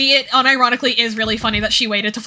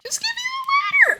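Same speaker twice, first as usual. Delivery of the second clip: angry crying. Real, not acted. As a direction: wail it out in frustration. Crying shout at game.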